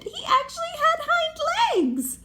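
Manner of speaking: very high, loud astonished cry; delighted high amazement; amazed exclamation. Real, not acted. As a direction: squeal in wonder. Storyteller amazed.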